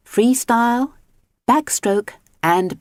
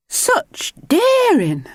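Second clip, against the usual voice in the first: much higher, slow, amazed exclamation; exclaimed; 3 dB louder, much slower than usual. Acted. Missus Grinling watching her husband surf, amazed. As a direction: marvel at him. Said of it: "she exclaimed".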